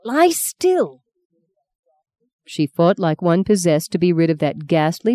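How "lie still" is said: higher, strained insistence on Lie still; harsher repeated command. Acted. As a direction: push the second Lie still up and sharper.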